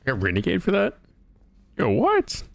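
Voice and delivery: high-pitched, indignant disbelief; incredulous. Real, not acted. Player protesting a renegade penalty in the game, incredulous. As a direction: blurt it out, pitch shooting up in surprise.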